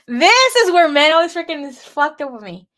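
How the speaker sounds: loud, high exasperated rant; angry outburst. Real, not acted. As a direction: vent your anger loudly. Outburst about men blaming her.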